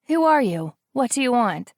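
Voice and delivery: high, loud frightened challenge; a woman startled at her door at night; startled alarm. Acted.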